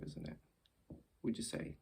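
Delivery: ordinary talk, nothing marked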